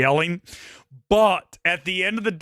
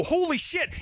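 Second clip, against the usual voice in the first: shocked; 13 semitones higher, 3 dB quieter than usual. Retold or imitated. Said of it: "I look and I go".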